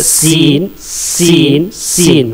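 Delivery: सीन with a clean hissing s; letter demo. Shown as a demonstration. Teacher modelling the letter to students, clean s. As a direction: hiss सीन with the tongue tip behind the teeth. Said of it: "जुबान अंदर ही रहेगी".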